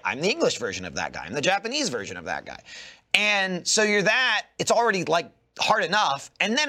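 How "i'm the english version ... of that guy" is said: voice actor voice. Retold or imitated. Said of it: "and then you're like".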